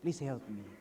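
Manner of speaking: soft, broken plea for help; mild crying plea. Retold or imitated. Weeping worship leader begging the group for help. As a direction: beg quietly through tears. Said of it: "And he cried".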